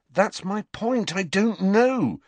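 high, exasperated outburst; frustrated insistence, rising in force. A narrator's reading. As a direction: let frustration push the pitch right up, drawing out the last words.

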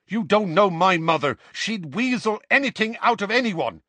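high, loud, exasperated retort; angry snap. A narrator's reading. Seamus tells Dean about his mother, snapping. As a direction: snap at him, voice climbing. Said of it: "Seamus snapped at him".

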